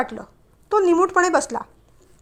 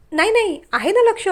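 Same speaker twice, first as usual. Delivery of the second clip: quick, higher flustered no no; flustered denial; 7 semitones higher, 3 dB louder than usual. Acted. Woman caught not listening, flustered and higher. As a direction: caught out, rushing to deny.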